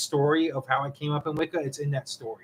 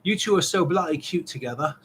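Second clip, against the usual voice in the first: put-on English accent. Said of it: "put an English accent to it".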